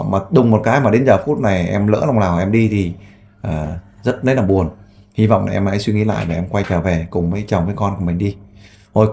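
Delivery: volunteer addressing the viewers, earnest low appeal; earnest public appeal. Real, not acted. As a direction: plead with the runaway mother to come home.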